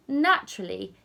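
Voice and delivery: ordinary delivery, nothing marked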